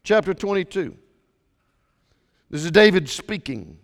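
ordinary delivery

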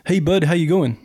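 lightly voiced Canadian driver; imitated Canadian accent. Driver greeting his passenger, mock Canadian accent. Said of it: "sorry, I apologize for the Canadian accent".

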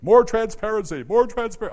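imitating a member's nagging refrain; mock nagging. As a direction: lightly imitate a pushy member. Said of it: "constantly telling me".

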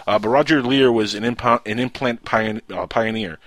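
stumbling over the words, restarting; tongue-tied. Real, not acted. Host introduces the late implant pioneer, tongue-tied. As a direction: trip over the phrase and try it over. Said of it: "See, I'm all tongue-tied".

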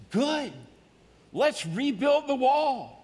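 high, loud, slowed cry of assent; eager reply. Acted. Builders eagerly agreeing with Nehemiah. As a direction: answer like a crowd ready to work.

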